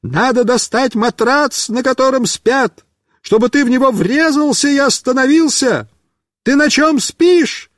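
high eager yelled plan; shouting. Acted. Mishka shouts his mattress plan, eager shouting. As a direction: call out the idea. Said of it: "Мишка кричит".

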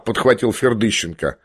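usual speaking voice

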